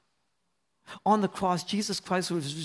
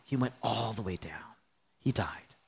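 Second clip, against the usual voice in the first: lower, hushed whisper; 9 semitones lower, 7 dB quieter than usual. Acted. Preacher whispering how far Jesus went down. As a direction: let it sink into a whisper.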